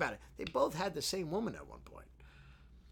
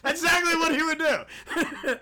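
laughing; 11 semitones higher, 8 dB louder than usual. Real, not acted. Laughing high voice of reverend.

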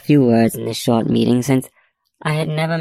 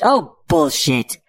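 high, drawn-out exclamation; indignant outburst; 13 semitones higher, slower than usual. A narrator's reading. Police chief exploding at an FBI agent, indignant outburst. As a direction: let it burst out high and slow. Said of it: "Roberts lets out".

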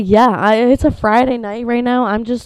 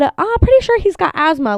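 higher, louder breezy vet voice; mock the casual doctor, higher; imitating the vet; 10 semitones higher, 5 dB louder than usual. Owner imitating vet, breezy higher voice. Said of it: "She said".

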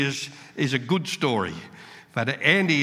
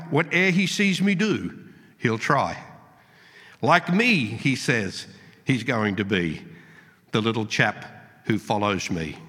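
poem recital; 5 semitones lower than usual. Acted. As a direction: recite it gently, letting each line fall. Reads a poem aloud.

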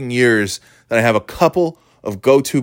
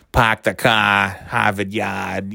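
exaggerated Boston accent; slower than usual. Shown as a demonstration. Showing off the cliché Boston accent, exaggerated. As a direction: caricature Boston accent, slow and drawn out. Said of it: "that kind of Boston accent".

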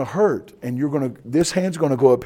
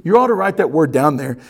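normal talking voice

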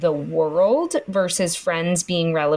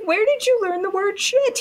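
much higher, astonished; shocked question; 14 semitones higher than usual. Retold or imitated. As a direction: ask it in mock outrage and amazement. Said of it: "I was like".

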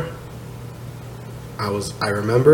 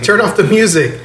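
raised, high mock order; imitated bouncer call; 8 semitones higher than usual. Bouncer telling the DJ to stop, mock calling out. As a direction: call it over the music, half in jest.

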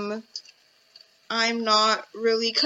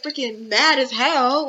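ordinary talk, nothing marked

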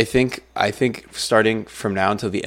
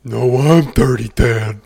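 slow, louder cartoon voice; gruff Dirty Dan; 3 semitones higher, 7 dB louder, slower than usual. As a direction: growl it like a grimy cartoon guy. Jokingly claiming the Dirty Dan role.

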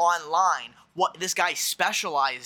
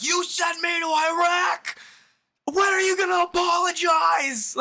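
imitated angry yelling; 5 dB louder than usual. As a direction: yell it like a heckling war vet. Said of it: "Dude, yelling at Bush".

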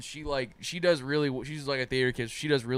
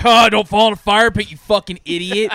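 loud mocking jeer; mocking friends; 5 semitones higher, 11 dB louder than usual. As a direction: jeer at him like a smug friend. Friends mocking him in group chat.

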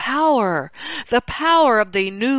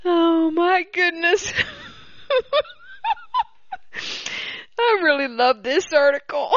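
higher voice, breaking up with giggles; delighted laughter; 8 semitones higher than usual. Real, not acted. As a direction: gasp it out between real laughs. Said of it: "I hope you're laughing at this as much as I am".

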